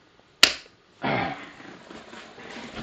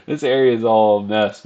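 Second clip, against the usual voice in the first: usual speaking voice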